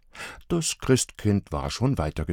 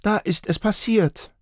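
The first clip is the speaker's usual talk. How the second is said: put-on high child voice; reporting child; 13 semitones higher than usual. A narrator's reading. Little girl reporting to parents, high child voice. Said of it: "berichtete Marla".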